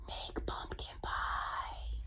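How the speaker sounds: slow, hushed whisper; drawn-out storyteller's whisper. A narrator's reading. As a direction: whisper it like a delicious secret. Secretive ending to a sentence.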